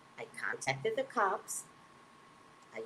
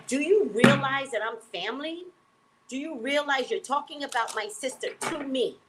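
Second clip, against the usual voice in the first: raised, sharp telling-off; scolding the group home staff, half-raised and indignant; 7 semitones higher than usual. Retold or imitated. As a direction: put them in their place.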